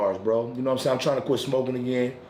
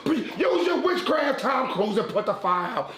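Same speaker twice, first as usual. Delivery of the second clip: coughing man pleading to Jesus and Tom Cruise; mock desperate prayer; 10 semitones higher than usual. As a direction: beg the Lord for rescue like a comic martyr. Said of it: "praying to Lord".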